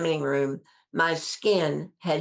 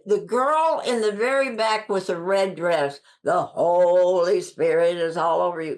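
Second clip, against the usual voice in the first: imitated dramatic healer's call from the stage; Catherine Kuhlman impression; 2 semitones higher, 4 dB louder than usual. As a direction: stretch "Holy" out grandly, imitating a stage healer. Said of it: "that's the way she talked".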